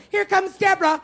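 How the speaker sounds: high mock announcement shout; calling out. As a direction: call it out high, as if spotting someone coming. People spot her squeaking far off.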